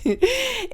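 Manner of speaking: a sudden squeal of a laugh; high squeaky laugh. Real, not acted. Vlogger cracks up at own mimicry, high squeal. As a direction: burst into a shrill giggle.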